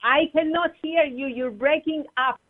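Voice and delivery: normal talking voice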